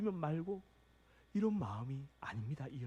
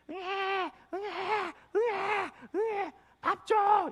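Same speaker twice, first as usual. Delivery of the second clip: put-on high, loud wailing baby cry; 7 dB louder, much slower than usual. Preacher imitates a baby crying for milk, high loud wailing. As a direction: wail like a hungry infant at night. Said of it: "아기가 새벽 세 시에 일어나서 웁니다".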